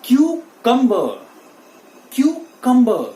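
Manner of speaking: "cucumber" loud, slow, high: KYOO... KUM-buh; slow model pronunciation. Shown as a demonstration. Teacher leading learners to repeat, cucumber slowly as KYOO-KUM-buh. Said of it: "फिर से मेरे साथ बोलिए".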